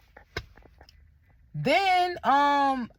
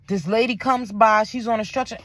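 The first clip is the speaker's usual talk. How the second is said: ordinary talk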